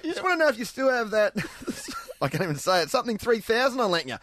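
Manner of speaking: lightly hammed Australian bloke; mock Aussie bloke. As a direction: ham up an ocker mate through laughs. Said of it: "improvising Aussie comment".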